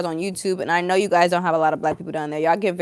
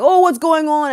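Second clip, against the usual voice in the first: higher, louder surprised question; walking-in supervisor; 7 semitones higher, 7 dB louder than usual. Retold or imitated. Supervisor walks in on a fight, mock surprise. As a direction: burst in asking as the supervisor. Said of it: "a supervisor comes in the room".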